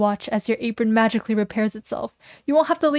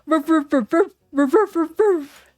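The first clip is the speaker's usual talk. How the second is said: dog barking; 6 semitones higher than usual. Acted. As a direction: bark as the dog lunges. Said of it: "Sprinkles jumps up and bites".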